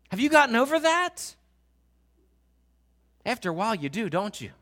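impassioned preaching. Acted. Pastor urging the church about prayer, full-force preaching. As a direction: preach it out to the congregation.

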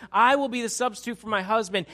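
loud, high declaration of a plea; impassioned pleading. Retold or imitated. Abigail stepping in for her husband, forcefully pleading. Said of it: "She's pleading for mercy".

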